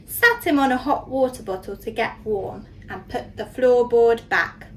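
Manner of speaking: ordinary delivery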